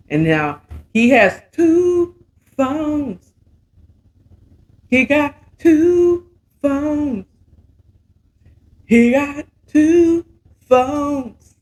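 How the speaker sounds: slow, high, stretching out two phones; milk the scandal slowly; high, slow, shocked emphasis on two phones; drawn-out emphasis. Real, not acted.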